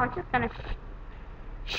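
breathy under-the-breath whisper. Real, not acted. Gamer whispering to himself while lining up a shot. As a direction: whisper it to yourself while aiming.

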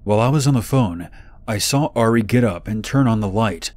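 plain reading voice

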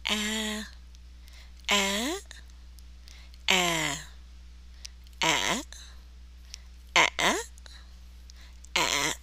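careful tone-by-tone vowel demo; six Vietnamese tones. Shown as a demonstration. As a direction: demonstrate six tones on a long "A", one at a time. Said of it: "let's hear the six possible sounds".

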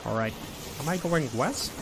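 high, froggy puppet voice held throughout; Kermit voice. Acted. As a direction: chirp it out like a felt frog. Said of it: "I'll say goodbye to Kermit".

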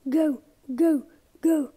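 ordinary talk

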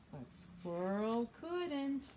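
storytime read-aloud; reading to children. Acted. Librarian reads a story to young children. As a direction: read the picture book to little ones.

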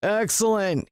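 high-pitched, gloating character voice; pleased villain. A narrator's reading. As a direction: draw it out high, pleased with the pet.

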